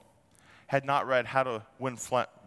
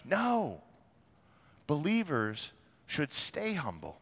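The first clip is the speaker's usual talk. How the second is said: ordinary delivery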